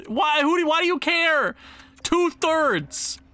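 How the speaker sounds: high, indignant complaint; worked-up disbelief at the demand; exasperated protest. Real, not acted. Arguing with rejected trade.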